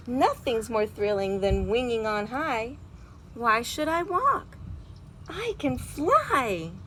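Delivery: lively storybook read-aloud with big feelings; reading to children. A narrator's reading. Teacher reading a picture book aloud to her class, animated. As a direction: read it like a bedtime story for kids.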